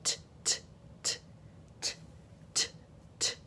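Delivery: crisp voiceless "t" taps with gaps; isolated T sound. Shown as a demonstration. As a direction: repeat the quiet "t" consonant, no vowel. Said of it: "begins with a T".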